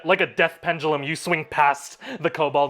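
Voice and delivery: ordinary talk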